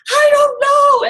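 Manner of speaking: high, loud cry breaking out; retold breakdown. To her husband while packing for the cottage, a high tearful outburst. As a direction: blurt it out as the tears come. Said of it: "I just lost it. ... I'm just bawling".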